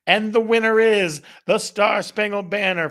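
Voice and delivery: loud, bright game-show reveal; winner announcement. Real, not acted. Host revealing the winner to viewers, excited.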